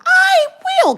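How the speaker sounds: very high, loud squeal; over-the-top thrilled promise; gushing agreement. Retold or imitated. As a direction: belt it out with exaggerated delight. Gushing thanks to helpful clerk.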